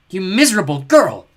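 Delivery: loud, slow furious hiss. Acted. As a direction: spit it with venom. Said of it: "she hissed".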